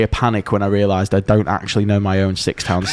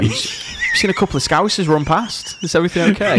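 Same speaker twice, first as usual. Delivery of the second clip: much higher, sweet concerned neighbour; innocent helpful passer-by; 8 semitones higher, faster than usual. Pretend. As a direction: act overly innocent to fool the shop. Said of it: "but as themselves".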